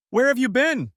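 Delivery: loud, high demand; demanding question. Acted. Husband confronting his wife about where she was, loud. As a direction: bark the question at her.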